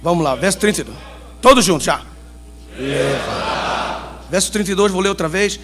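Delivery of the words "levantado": slow, stern prophet's call. Shown as a demonstration. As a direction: proclaim it like an angry prophet. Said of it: "com aquela voz de profeta, quando você assim tá meio zangado".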